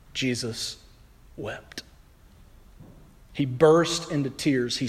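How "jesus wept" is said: solemn hush. A narrator's reading. Shortest verse read solemnly.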